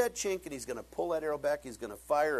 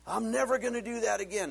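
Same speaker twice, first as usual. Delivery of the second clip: mock vow; 7 semitones higher than usual. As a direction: swear it off like a desperate man. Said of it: "And you said".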